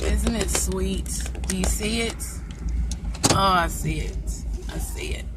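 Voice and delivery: hushed whisper. Real, not acted. Admiring a Range Rover.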